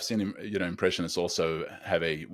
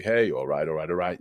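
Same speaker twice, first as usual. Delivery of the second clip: Matthew McConaughey; 2 semitones lower than usual. Retold or imitated. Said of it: "Matthew McConaughey".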